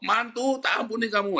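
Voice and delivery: high-pitched mother-in-law calling her in-law; playful high-voiced reply; acted mother-in-law. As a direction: answer high, forgiving the in-law. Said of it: "mertuanya sama mantunya sama-sama di sini".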